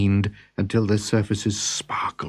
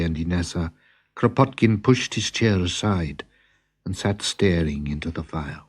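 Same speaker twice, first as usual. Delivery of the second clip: plain reading voice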